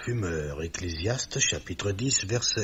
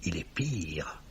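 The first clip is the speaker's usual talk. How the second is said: grim whisper; 5 semitones lower, 7 dB quieter than usual. Acted. Millet condemns Burt to Bessette in a grim whisper. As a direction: drop to a hushed, dark verdict.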